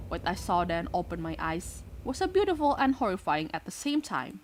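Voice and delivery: ordinary delivery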